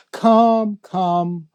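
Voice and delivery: high, loud, drawn-out summons; calling out. Acted. Guest recites Rumi's invitation, calling out. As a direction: call it out like a ringing invitation.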